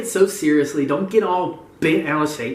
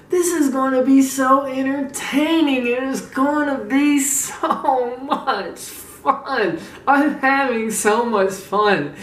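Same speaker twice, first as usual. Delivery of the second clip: slow, higher, savouring every word; drawn-out glee; 6 semitones higher, much slower than usual. Real, not acted. Gloating to viewers about triggering a rival, drawn-out glee. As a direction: stretch it out with gleeful relish.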